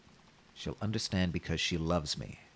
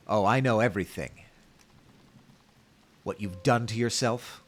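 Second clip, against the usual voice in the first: mildly louder, building accusation; rising volume; 4 semitones higher, 4 dB louder than usual. Acted. Mother confronting her returned daughter, growing louder. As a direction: let the voice swell with anger. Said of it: "Her tone grew in volume".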